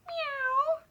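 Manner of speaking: high mewing cat sound; a squeaky little meow for the kitty; toy cat meow. Acted. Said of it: "he says to you".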